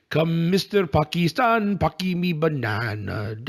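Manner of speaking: slow, drawn-out exaggerated "Pakistan"; mocking Trump's accent. Imitating Trump's mangled "Pakistan", slow and exaggerated. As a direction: stretch "Pakistan" like a bad foreign accent. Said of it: "I love the video".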